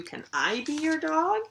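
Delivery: pleading little dog. Acted. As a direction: ask sweetly as the toast dog. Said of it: "said Toasty, which translates to".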